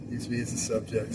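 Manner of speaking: ordinary talk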